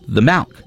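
The milk, with milk turned into "malk"; sister's mispronounced milk. Retold or imitated. Sister repeating the request when questioned, milk as "malk". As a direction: say milk as "malk", rising at the end. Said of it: "my sister said".

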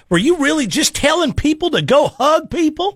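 high-pitched mock Bakersfield twang; Bakersfield accent. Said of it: "people talk like that over there".

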